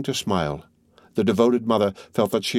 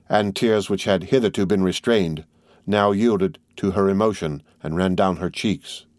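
usual speaking voice

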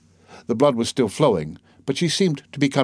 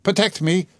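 higher-pitched, urgent appeal; a touch of alarm, pitch lifted; crying out for protection; 9 semitones higher than usual. A narrator's reading. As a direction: cry out mildly for help. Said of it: "she cried to the official".

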